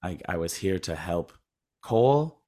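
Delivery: low, soft, measured acted quote; understated acceptance. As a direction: imitate quiet acceptance, without any freaking out. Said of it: "we didn't need a beat of Bruce Willis freaking".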